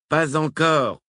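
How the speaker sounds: Brown crie vers la radio, voix plus aiguë; répondre fort; cri. A narrator's reading. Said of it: "cria Brown". (Brown crie sa réponse à la radio, un peu plus fort)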